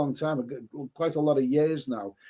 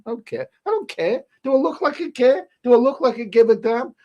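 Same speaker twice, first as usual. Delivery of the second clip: climbing higher and louder, I don't care; defiant scoff; 8 semitones higher, 5 dB louder than usual. Pretend. Streamer scoffing at critics in chat, rising defiant scoff. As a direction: toss it off, each line higher and louder than the last.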